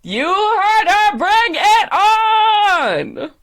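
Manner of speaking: high, loud, hugely drawn-out shout; announcer's hype yell. Acted. Host launching round three, shouting. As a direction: belt it to the crowd, stretching each word.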